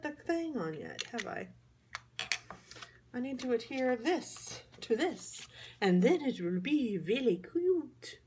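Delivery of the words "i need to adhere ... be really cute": Dracula imitation. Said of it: "That's my best Dracula imitation".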